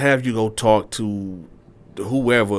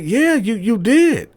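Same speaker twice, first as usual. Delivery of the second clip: much higher, louder, insisting; insisting girlfriend; 14 semitones higher, 6 dB louder, slower than usual. Retold or imitated. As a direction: hold firm. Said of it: "She was like".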